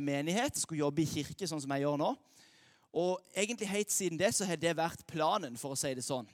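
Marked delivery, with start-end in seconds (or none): none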